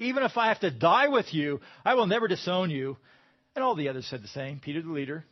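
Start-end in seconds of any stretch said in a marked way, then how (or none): 0.0-3.0 louder, higher, insisting hard; emphatic insistence. Acted. Preacher voicing Peter, insisting loudly. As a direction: Peter swears he'll never leave. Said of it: "Peter insisted emphatically".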